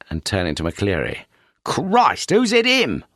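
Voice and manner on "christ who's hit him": higher, urgent alarm; detective shocked to find a bloodied man; shocked exclamation. A narrator's reading. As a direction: blurt it out in shock.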